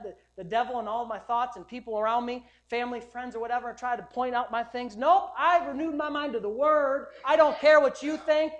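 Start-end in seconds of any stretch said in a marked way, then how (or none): 5.0-8.5 defiant preaching shout. Real, not acted. Pastor to congregation, rejecting everyone's judgment, loud defiant shout. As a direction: belt it out like you dare anyone to argue.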